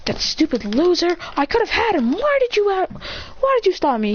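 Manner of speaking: high-pitched acted complaint; indignant outburst. Sasuke complains after the fight was stopped, high indignant outburst. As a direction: protest out loud, pitched well up. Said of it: "then Sasuke says out loud".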